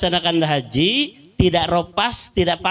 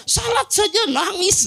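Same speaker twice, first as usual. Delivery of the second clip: marvelling. Real, not acted. Marvels at wife's weeping.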